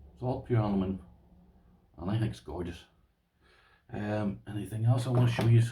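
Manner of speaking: usual speaking voice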